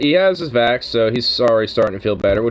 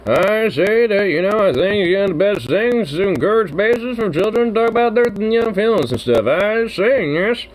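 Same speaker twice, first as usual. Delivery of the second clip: put-on old-timey grandfather voice; 9 semitones higher than usual. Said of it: "The old-timey old, you know, voice".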